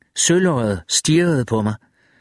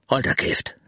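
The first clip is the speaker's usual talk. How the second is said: stunned hush; 5 dB quieter than usual. A narrator's reading. Wireman stunned by the ghost.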